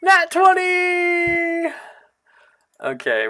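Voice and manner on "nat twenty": high, stretched-out cheer; excited shout. Real, not acted. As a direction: yell it out in triumph. Celebrating a natural twenty.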